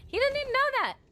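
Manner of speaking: high, loud burst of disbelief; excited squeal. Real, not acted. Streamer squealing to chat about donors. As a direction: squeal it out in delight.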